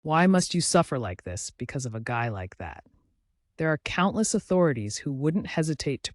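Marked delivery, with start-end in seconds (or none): none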